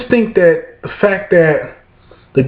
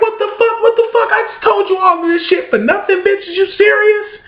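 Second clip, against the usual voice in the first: high-pitched outraged squeal; outraged disbelief. Retold or imitated. Father reading scribbles on his file, squealing high in outrage. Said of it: "I'm like".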